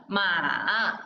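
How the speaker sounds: Ma falling low then climbing; teacher models the third tone. Shown as a demonstration. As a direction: bend Ma down, then up again. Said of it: "nod your head like this".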